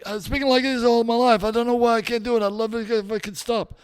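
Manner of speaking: high-pitched American patient; high joke voice with an American accent. Acted. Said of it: "had an American accent".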